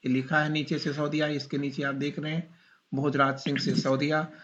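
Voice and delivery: usual speaking voice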